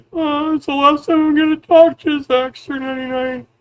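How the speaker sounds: very high-pitched mock crying voice; mock tearful whine. Vlogger mimicking his critic's last farewell, mock crying. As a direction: imitate a critic about to cry, hammed up. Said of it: "sounds like you're about to cry".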